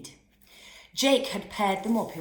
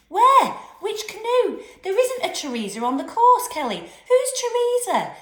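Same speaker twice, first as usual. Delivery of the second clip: much higher, louder urgent shouting; 14 semitones higher, 9 dB louder than usual. A narrator's reading. Teachers rushing to a drowning, urgent shout. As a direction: shout in alarm. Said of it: "they shouted urgently".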